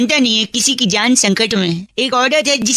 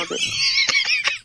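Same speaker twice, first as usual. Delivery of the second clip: high burst of laughter; 7 dB quieter than usual. Real, not acted. Prankster laughs.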